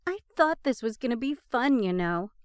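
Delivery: ordinary talk, nothing marked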